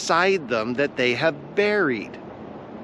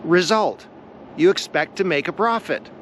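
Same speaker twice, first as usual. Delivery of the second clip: normal talking voice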